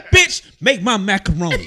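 loud laughing mock demand; mock husband yelling for macaroni; mock bossy husband. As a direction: bark it like a spoiled husband, laughing.

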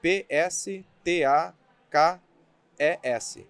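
P S T A K E S spelled letter by letter, slowly; spelling it out. Shown as a demonstration. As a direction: spell P S T A K E S one letter at a time. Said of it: "Vou soletrar".

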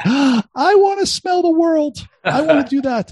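very high, slow squeal of yearning; high-pitched mock-thrilled cry; wide-eyed excitement. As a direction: squeal it like a hammed-up starstruck youngster. Acts out his younger self's excitement.

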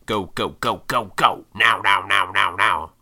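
loud, punched rapid-fire repeats; hectic urgency. Retold or imitated. Vlogger imitating the rush of city life, hectic urgency. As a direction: mimic the relentless push of city life.

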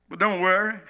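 ordinary talk

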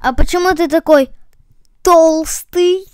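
loud wondering question, last word set apart; pause, then marvel at the last word; surprised. Acted. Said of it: "удивился живот". (удивлённо, громко)

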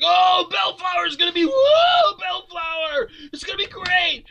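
high, shrieking fan excitement; hammed-up crowd gushing over a movie; mock hype. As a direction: squeal it like an overexcited fan. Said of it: "everybody's like".

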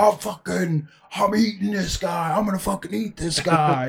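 hammed-up madman yell held back in volume; crazed biter. Acting out a biter scaring onlookers, crazed mock yell. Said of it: "say something like I just said".

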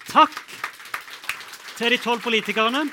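loud, high-pitched thanks; rousing host's thank-you; calling for applause. Real, not acted. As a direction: project it over a clapping room. Host calls for applause to politicians.

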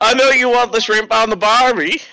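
high, loud, badly faked Boston accent; cracking-voiced accent impression. Game priest taunting a traveler, cracking mock Boston accent. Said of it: "the cracking in the voice is perfect".

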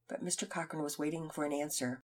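usual speaking voice

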